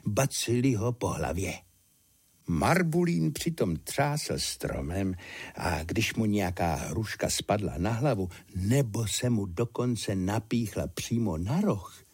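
ordinary delivery

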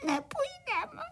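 high-pitched mock crying of little ones; tearful wailing. A narrator's reading. Frightened little crickets refuse the cart, crying. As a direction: whimper it high, stretching the last word. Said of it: "malí cvrčci rozplakali".

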